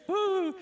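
a sudden shrill whoop of joy; excited high whoop. Real, not acted. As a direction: whoop it out, delighted. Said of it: "excited cheer".

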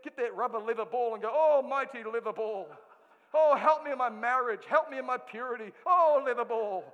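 hammed-up loud pleading to a ball; mock prayer. Preacher mocking sports fans praying to a ball, loud mock pleading.

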